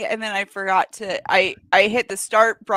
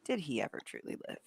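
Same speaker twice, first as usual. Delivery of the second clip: deep philosophical musing; 4 semitones lower than usual. Acted. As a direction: ask it like a brooding sage. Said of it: "and he says".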